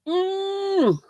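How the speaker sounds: a high, soft "mm" like a squeeze; hugging hum. Pretend. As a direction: hum back a warm pretend hug. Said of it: "That's my hugging you back".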